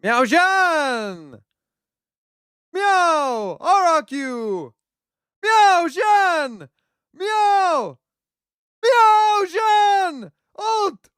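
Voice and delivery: cat commentator losing it over the clutch; excited cat calls. Pretend. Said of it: "That's as a cat".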